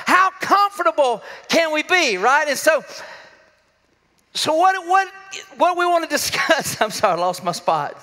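normal talking voice

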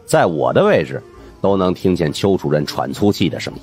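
ordinary delivery

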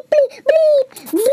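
loud, very high censor bleeps; imitated cussing. Everyone cussing after being thrown out, shrieked bleeps. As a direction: shriek long bleeps in place of swearing. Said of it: "starts cussing each other".

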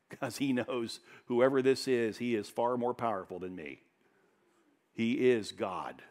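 normal talking voice

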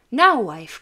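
husband calls loudly to his wife; loud call across the house. A narrator's reading. Husband home at noon calls to wife, loud. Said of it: "cried he".

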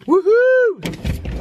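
high, delighted squeal of a woohoo; excited whoop. Real, not acted. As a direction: let out a happy high whoop. Cheering after a gift.

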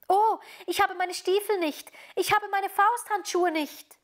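put-on high, loud dismayed cry of a little girl; the little girl calls out in sudden dismay, pitched high and loud. Gerda realizes in the cold she has no boots, high loud cry. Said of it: "rief die kleine Gerda".

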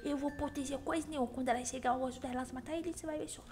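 soft, quick secret aside; whispered aside. Acted. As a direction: confide the plan to viewers.

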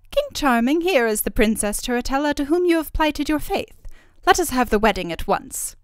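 high, loud fairy voice; fairy, imperious. A narrator's reading. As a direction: formally announce as a commanding fairy.

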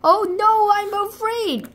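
high, hammed-up fear; mock fright. Wolf facing the foxes, mock high fright. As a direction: squeak it out like a scared wolf.

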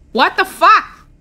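high-pitched, jolted outburst; startled. Real, not acted. Gamer scolds the character who scared them, high startled yelps. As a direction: react as if just given a jump scare. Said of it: "Damn, you scared me".

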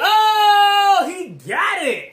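thrilled, laughing outburst; excited shout. Real, not acted. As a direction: whoop that he got it. Celebrating a right answer.

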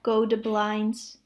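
usual speaking voice

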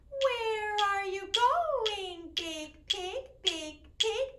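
slow, sing-song calling out to the pig; a lightly voiced farmer asking a question; little farmer voice. Teacher voicing the farmer for the children, sing-song. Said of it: "when I'm talking like the little farmer".

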